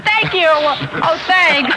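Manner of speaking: mock gratitude. Mock grateful taking flyer.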